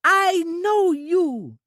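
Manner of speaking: put-on bursting with childish joy at a friend; high, slow, loud childlike delight; delighted Trudy. A narrator's reading. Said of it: "Trudy said delightedly".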